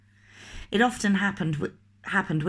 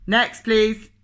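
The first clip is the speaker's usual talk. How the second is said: loud shouted next please; loud call; 4 semitones higher, 7 dB louder than usual. Acted. Butcher loudly calling the next customer.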